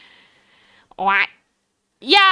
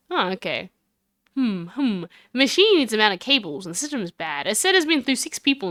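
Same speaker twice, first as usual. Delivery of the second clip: usual speaking voice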